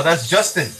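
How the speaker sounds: ordinary delivery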